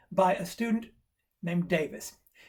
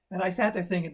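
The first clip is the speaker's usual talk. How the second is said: ordinary talk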